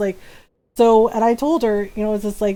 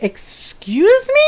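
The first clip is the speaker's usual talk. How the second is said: shocked excuse me; 12 semitones higher, 3 dB louder, much slower than usual. Retold or imitated. Acted shocked reply to joke.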